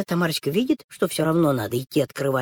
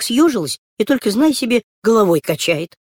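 ordinary delivery, nothing marked

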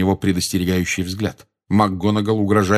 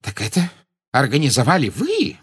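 higher, incredulous question; astounded; 6 semitones higher than usual. A narrator's reading. Minister confronts Dumbledore over the group, astounded. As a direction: ask in rising disbelief.

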